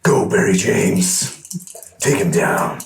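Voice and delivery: slow, drawn-out hoarse whisper-cry; battle command. Acted. Player sending his cockatrice into the fight, slow mock battle call. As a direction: send the pet in with a slow, voiceless call.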